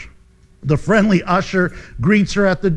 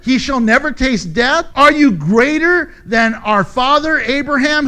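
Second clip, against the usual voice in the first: loud, high, indignant accusers; scornful crowd confronting a man they reject; accusing challenge; 5 semitones higher, 7 dB louder than usual. Acted. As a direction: hurl the accusation at raised pitch and volume. Accusers challenge Jesus in acted reading.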